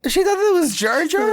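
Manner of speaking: very high, loud disbelief; real incredulous outburst. Real, not acted. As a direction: repeat it back in squealing amazement. Stunned by sister's absurd guess.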